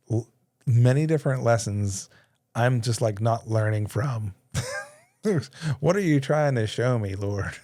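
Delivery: ordinary talk, nothing marked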